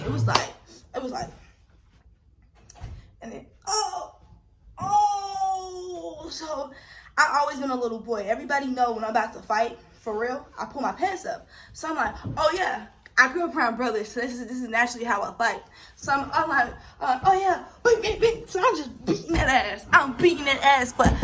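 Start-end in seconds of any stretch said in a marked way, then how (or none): 3.2-6.3 sudden high cry of pain out of a laugh; laughing yelp of pain. Retold or imitated. Reliving the punch, crying out. 17.0-18.4 high laughing bing bing as she mimes the punches; gleeful punch sound effects; punch noises. Retold or imitated. Acting out her own punches.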